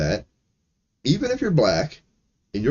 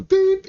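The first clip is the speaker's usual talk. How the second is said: a sharp, high beep; a mock bleep, loud and high; high censor beep; 5 dB louder than usual. Censoring a streamer's slur with a high beep. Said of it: "do something".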